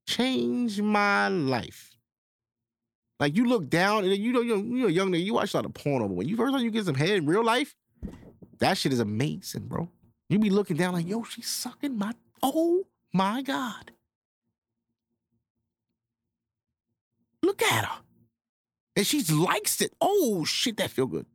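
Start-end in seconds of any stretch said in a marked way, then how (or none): 0.1-1.9 drawn-out awestruck recollection; dreamy slow reverence. Real, not acted. 11.1-21.3 high, amazed, breathless disbelief; awestruck young self. Acted. Acting out his younger self.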